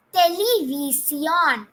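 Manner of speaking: Television drawn out as te-le-vee-see-ON; slow Spanish model. Shown as a demonstration. Teacher leading students' first repeat, slow te-le-vee-see-ON. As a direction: say Television slowly, every syllable clear, te-le-vee-see-ON. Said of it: "let's pronounce it the first time".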